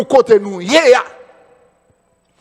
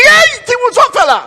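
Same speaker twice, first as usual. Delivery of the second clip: calling out; 13 semitones higher than usual. Retold or imitated. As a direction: yell at the little one from across the room. Man calls out to the kid.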